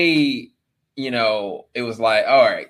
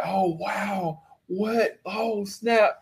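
mock amazement; 7 semitones higher, 6 dB quieter, much slower than usual. Imitating Netflix discovering idea.

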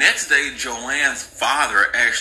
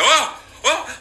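panicked gasp; 11 semitones higher than usual. Retold or imitated. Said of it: "He's like".

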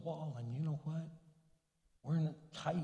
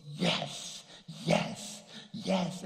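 gleeful yes; 4 dB louder, much slower than usual. Retold or imitated. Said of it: "And he goes".

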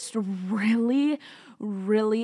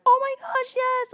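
high-pitched gushing yes; excited agreement; 4 dB louder than usual. Retold or imitated. Friend agreeing to go to the service, excited. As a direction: imitate a thrilled friend, much higher and louder.

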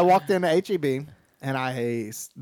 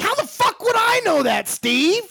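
high, loud, incredulous yell; outraged disbelief; 5 dB louder, slower than usual. Retold or imitated. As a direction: hurl it back, slowing down at the end. Outraged retort to scammer.

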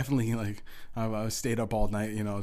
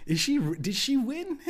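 much higher, squeaky disbelief; incredulous outburst; 12 semitones higher, 3 dB louder than usual. Retold or imitated. Gamer confronting his distracted buddy on mic, incredulous. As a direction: retell it as an astonished high-pitched protest.

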